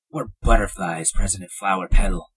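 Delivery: usual speaking voice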